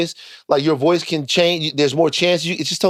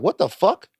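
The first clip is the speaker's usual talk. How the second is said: higher-pitched incredulous reaction; retold disbelief, pitched up; baffled disbelief; 7 semitones higher than usual. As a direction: act out being baffled at first sight. Quoting their first baffled reaction.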